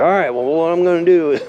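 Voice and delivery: dad announcing plan. Retold or imitated. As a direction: lightly imitate dad laying out a scheme.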